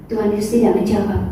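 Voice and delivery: ordinary talk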